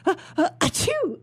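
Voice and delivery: big high-pitched sneeze. Acted. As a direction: wind up and sneeze with "ah, ah". Narrator sneezes in story.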